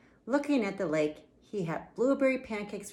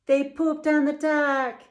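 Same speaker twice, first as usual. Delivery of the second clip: gleeful reveal; 6 semitones higher, 5 dB louder than usual. A narrator's reading. Storyteller's big reveal.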